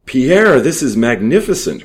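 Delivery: high, loud thrilled cry; excited cry. A narrator's reading. Thief crying out to his partner over the cash, excited. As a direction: cry out in delight at the money. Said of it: "cried Jules".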